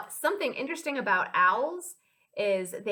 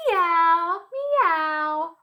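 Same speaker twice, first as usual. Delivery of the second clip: higher drawn-out meows; cat meow; 6 semitones higher, 7 dB louder than usual. Pretend. Teacher voicing a cat for children, playful meowing. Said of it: "Hear that funny sound?".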